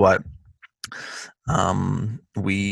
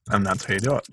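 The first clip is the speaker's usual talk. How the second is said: normal talking voice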